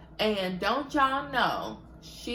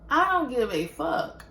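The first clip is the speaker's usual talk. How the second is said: defiant outburst; 7 semitones higher, 5 dB louder than usual. Retold or imitated. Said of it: "I said".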